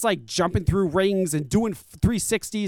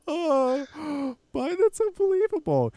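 laughing disbelief; 9 semitones higher, 4 dB quieter, slower than usual. Real, not acted. Still laughing after the joke.

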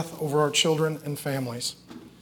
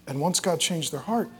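ordinary delivery

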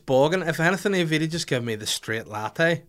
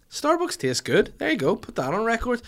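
mock forced praise; 10 semitones higher than usual. To the podcast audience, hammed-up grudging praise of Starbucks. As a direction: sing out the praise with mock sincerity.

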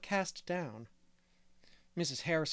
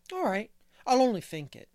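put-on bright, louder and higher boy's reply; chirpy cheek, shrugging off the rule; cheerful; 6 semitones higher, 7 dB louder than usual. A narrator's reading. Davy answering Anne's scolding, cheerfully. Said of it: "said Davy cheerfully".

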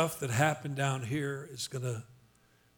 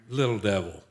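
much lower, falling, dismissive tone; belittling low voice; 7 semitones lower than usual. Real, not acted. Preacher shrinking the devil before congregation, low belittling tone. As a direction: drop LITTLE down low, dismissively. Big versus little, acted by voice.

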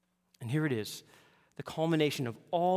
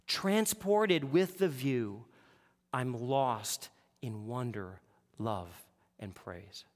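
slow, reverent hymn reading; a slow recited hymn verse; 3 semitones lower, much slower than usual. Acted. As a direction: savour each line slowly. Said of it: "one author says it like this".

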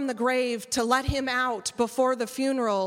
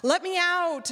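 high-pitched retold cry to be let out; mock scream; 6 semitones higher than usual. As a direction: plead high, in a half-contained yell.

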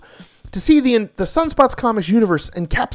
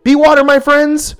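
loud, high dramatic catchphrase; punchy dramatic comic narration; 9 semitones higher, 7 dB louder than usual. Acted. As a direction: deliver it like a martial arts master.

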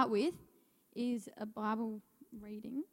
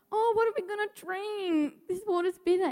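mock the grumbling crowd in a high whine; whiny complaining Israelites; 10 semitones higher, 7 dB louder than usual. Preacher mimicking the Israelites grumbling to Moses, high mock whine. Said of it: "and yet they're going".

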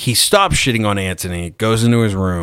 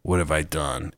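hushed, sinking "oh no" in a much softer voice; soft, retold self-reproach; quiet dismay; 6 semitones lower, 10 dB quieter than usual. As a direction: murmur it to yourself as the regret hits. Retold dismay backstage after interview.

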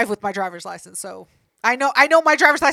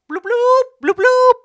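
high, loud bloop bloop of a police siren; siren imitation; 12 semitones higher, 9 dB louder than usual. Narrator imitating the cop car pulling her over, high siren bloops. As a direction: chirp it like an undercover cop car's siren.

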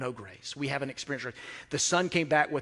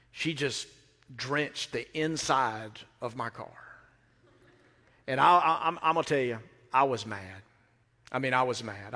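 ordinary talk, nothing marked

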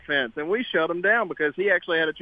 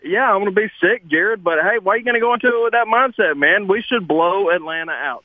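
loud, fired-up push back; rally the host, louder and louder; excited rant; 3 semitones higher, 5 dB louder than usual. Real, not acted. Caller fired up arguing.

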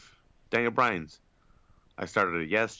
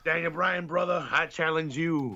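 wrestling promo; 5 semitones higher, 4 dB louder than usual. Retold or imitated. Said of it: "I was like".

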